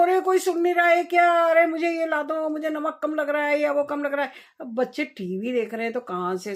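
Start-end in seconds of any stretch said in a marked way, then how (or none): 0.0-4.3 re-enacted shout, higher and much louder; retold yell. Mother yelling to kids watching TV, loud irritated call. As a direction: call out across the house to kids who aren't listening. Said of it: "अब मैं यहाँ से चिल्ला रही हूँ".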